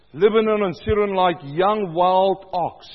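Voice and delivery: loud, measured psalm reading; booming scripture proclamation; proclaimed scripture. Acted. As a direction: declaim it to the congregation.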